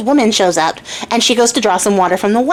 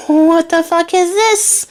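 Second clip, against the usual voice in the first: slow, higher, appalled disbelief; high, slow shocked king; stunned king; 7 semitones higher, much slower than usual. Acted. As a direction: drag it out in horror. King sees the ugly daughter.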